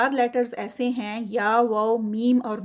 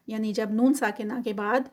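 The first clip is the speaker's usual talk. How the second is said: ordinary delivery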